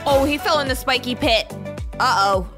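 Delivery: ordinary delivery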